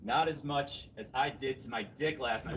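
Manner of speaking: ordinary talk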